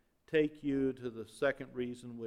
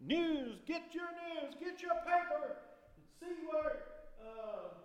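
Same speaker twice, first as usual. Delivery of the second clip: high-pitched street-seller cry, held back in volume; mock newsboy yell. Newsies selling papers in the streets, mock high yelling. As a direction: imitate a paperboy hawking headlines from afar. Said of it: "they would yell out".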